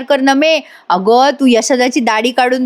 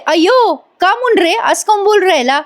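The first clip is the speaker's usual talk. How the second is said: put-on teasing young woman; 8 semitones higher than usual. Nami teases Dhanya.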